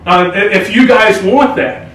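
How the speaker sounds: loud pep talk yell; rally the team, shouting; fired-up shout. Real, not acted. Coach pep talk before game.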